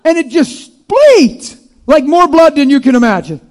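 loud, high, driving preacher voice; full-force preaching. Real, not acted. Preacher pressing the point on the congregation, loud and high.

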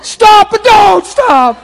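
very high, loud and slow; drawn-out cry. Retold or imitated. Preacher recalls pleading with God, loud slow cry. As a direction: stretch each word in awe. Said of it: "one of them paradox places".

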